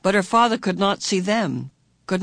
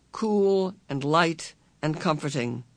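usual speaking voice

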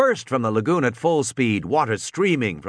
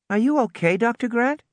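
put-on concerned girl; 11 semitones higher than usual. A narrator's reading. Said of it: "Lex asked".

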